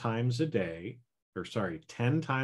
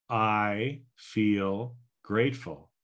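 slow, deliberate I feel grateful; slow affirmation; much slower than usual. Shown as a demonstration. Coach demonstrating the ten-by-three affirmation slowly. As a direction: say it slowly, calmly, with conviction. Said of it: "so here we go again".

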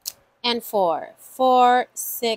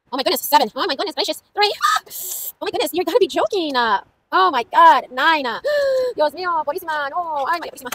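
high, fast, gasping disbelief; real shocked excitement; 5 semitones higher, much faster than usual. Real, not acted. Host reacts to dice rolls adding up, shocked excitement. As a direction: exclaim over each new number, breath catching.

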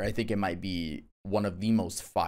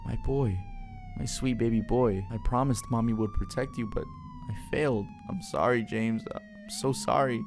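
higher-pitched, broken-hearted acted lament; grieving apology; 5 semitones higher than usual. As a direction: mourn your child, begging forgiveness, with pauses. Parent grieves over dead child.

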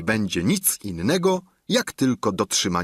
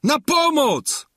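high, loud, panicked calling out; terrified squire shouting for his master; frightened cry for help; 4 dB louder, slower than usual. A narrator's reading. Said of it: "zawołał". (przerażone, głośne wołanie o pomoc)

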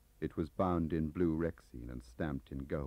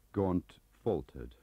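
ordinary delivery

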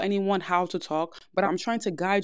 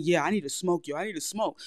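mock tough stoner. Shown as a demonstration. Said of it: "how I sound saying that".

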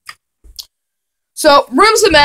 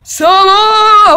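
high, louder hammed-up "Summer!"; mock excited shout; 8 semitones higher, 4 dB louder than usual. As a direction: belt out "Summer!" like a cheesy weatherman. Shouted weather announcement.

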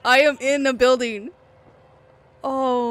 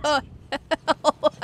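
words chopped up by real laughing; laughing disbelief. Real, not acted. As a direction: break into laughter mid-phrase. Cracking up at being stuck.

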